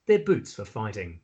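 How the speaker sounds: ordinary talk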